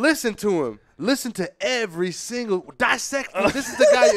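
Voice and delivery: high-pitched, building hype-man exhortation. Retold or imitated. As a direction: imitate an announcer preaching up the next act. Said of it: "this other guy comes up and is like".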